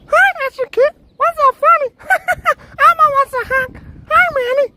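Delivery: very high, loud squeaky puppet voice; Elmo voice. Acted. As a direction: chirp like Elmo greeting the crowd. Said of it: "what happened, Elmo?".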